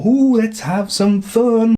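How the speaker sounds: high, slow teasing purr. Retold or imitated. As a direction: play the coy girlfriend luring him. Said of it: "and go".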